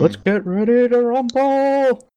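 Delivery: slow, high ring announcer call; wrestling promo. Acted. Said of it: "we could do this all in wrestling promo voice".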